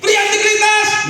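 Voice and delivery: shouted call. Real, not acted. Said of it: "Berdiri, ayo kita".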